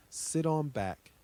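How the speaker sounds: plain reading voice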